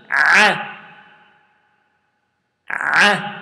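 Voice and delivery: A said as a throaty ayn, not hamza; ayn demo. Shown as a demonstration. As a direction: squeeze the A from the throat. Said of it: "It is ayn, and it is not hamza".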